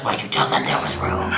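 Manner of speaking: low whispered reproach; hushed scolding. Retold or imitated. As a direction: hiss it under the breath at a coworker. Manager whispers to coworker.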